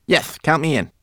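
high little character voice; small, high-pitched volunteer; Twigleg, eager little mannequin. A narrator's reading. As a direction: chime in eagerly.

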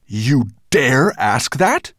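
slow, loud bellow. Acted. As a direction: bellow it in outrage. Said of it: "bellowed Nettlebrand".

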